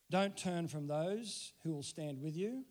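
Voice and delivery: ordinary talk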